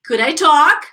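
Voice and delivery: careful question rising on "talk"; pronunciation model. Shown as a demonstration. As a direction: lift "talk" clearly at the end. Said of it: "let's check pronunciation".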